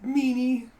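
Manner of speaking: put-on high, loud childish complaint; pouty onlooker. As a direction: whine it with a pout. Onlookers pout at Zeka.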